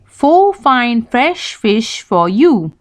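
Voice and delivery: slowly, each F crisp; slow, clear tongue twister. Shown as a demonstration. Teacher modelling a tongue twister slowly. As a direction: say it slow and clean. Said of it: "Try this one".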